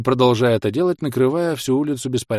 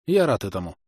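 matter-of-fact. A narrator's reading. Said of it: "также буднично отозвался я".

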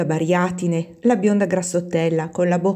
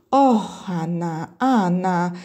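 drawn-out, scandalized reproach; much slower than usual. A narrator's reading. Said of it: "dissero quasi tutte scandalizzate". (rimprovero scandalizzato, strascicato)

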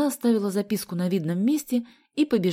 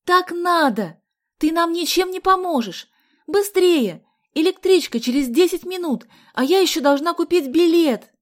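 high, loud, insistent yelling; urgent shouting; 11 semitones higher, 9 dB louder than usual. A narrator's reading. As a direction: yell orders in a hurry. Shouted argument, rushing for train.

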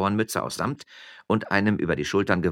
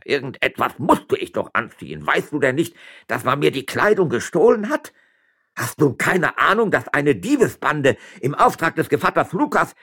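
high, loud, exasperated scolding; heated self-justification; indignant retort; 10 semitones higher, 7 dB louder than usual. A narrator's reading. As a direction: berate a fool, building in outrage. Magistrate indignantly berates the mayor.

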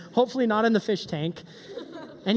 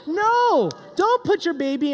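emphatic no; 5 semitones higher, 4 dB louder than usual. Acted. Teacher stopping bad idea.

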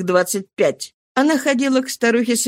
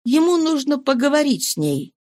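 usual speaking voice

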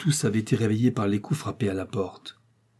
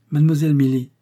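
a louder, higher call of her name; startled servant calling the young lady; 4 semitones higher, 6 dB louder, faster than usual. A narrator's reading. Servant calling out to shocked woman.

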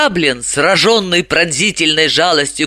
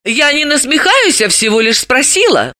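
high woman's voice, protesting innocence; raised, mild self-defence; defensive protest; 9 semitones higher than usual. A narrator's reading. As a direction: justify yourself lightly, pitched up. Dalila defends herself against reproach.

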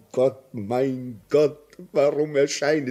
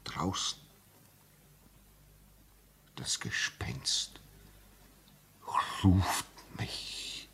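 hushed, low, slow whisper; fearful whisper; 9 semitones lower, 11 dB quieter, slower than usual. Acted. Signalman whispering to the doctor about the ghost, fearful whisper. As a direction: whisper it so the ghost won't hear.

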